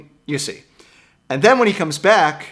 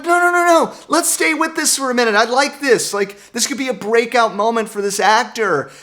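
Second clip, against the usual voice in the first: excited protest; 7 semitones higher than usual. Retold or imitated. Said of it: "I'm like".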